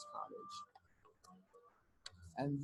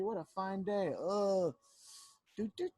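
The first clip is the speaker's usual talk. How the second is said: high hummed doot doot birdsong; half-remembered chirpy bird call; bird song; 3 semitones higher, 4 dB louder than usual. Acted. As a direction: hum a little bird tune up high. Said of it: "how the bird song goes".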